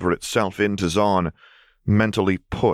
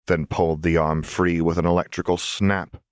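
usual speaking voice

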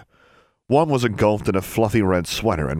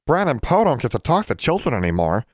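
higher-pitched, mournful elf voice; sad elf; 6 semitones higher than usual. A narrator's reading. Elf who rarely talks to children, sad. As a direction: lament it as a lonely elf. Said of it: "Bran said sadly".

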